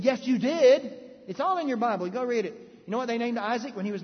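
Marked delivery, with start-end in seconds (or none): 0.0-2.5 angel insisting. Retold or imitated. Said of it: "He said".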